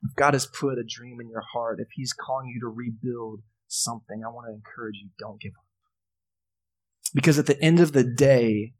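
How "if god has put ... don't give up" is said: soft, hushed pastoral appeal; tender encouragement. Real, not acted. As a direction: encourage them gently, fading quieter to the end. Closing encouragement to the congregation.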